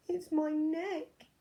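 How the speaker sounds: high, slow, mournful giraffe voice; a sniffly, sorry-for-itself giraffe; sad giraffe. A narrator's reading. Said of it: "who was sniffing sadly".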